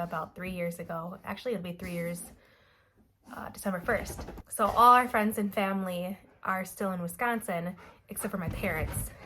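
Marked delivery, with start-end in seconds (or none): none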